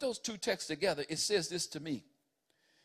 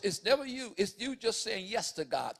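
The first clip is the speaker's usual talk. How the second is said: ordinary talk, nothing marked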